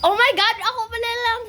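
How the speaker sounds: loud, high surprised cry; excited exclamation. Real, not acted. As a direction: burst out in surprise. Sudden realization.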